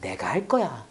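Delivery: very soft, low reassurance; God's soothing voice. Retold or imitated. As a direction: hush them gently, taking your time. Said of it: "음성이 많이 들리거든요, 하나님한테". (낮고 부드럽게 달래는 음성)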